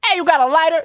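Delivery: high, strained holler; imitated street heckler. Asking strangers for a lighter, high mock holler. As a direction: impersonate a woman pestering passers-by. Imitating the alley woman accosting them.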